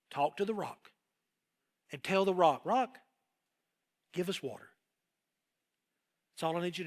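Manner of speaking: hushed and patient; gentle hushed command; soft instruction. Retold or imitated. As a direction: lay it out softly, like a calm father. God instructing Moses gently.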